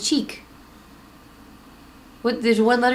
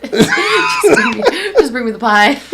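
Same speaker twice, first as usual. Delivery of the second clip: laughing demand; 4 semitones higher, 7 dB louder, slower than usual. Real, not acted. Eager laughing demand for pie.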